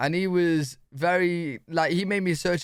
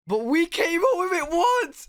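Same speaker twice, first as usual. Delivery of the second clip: hammed-up wounded British pride, shouted high; mock outraged cry; 12 semitones higher than usual.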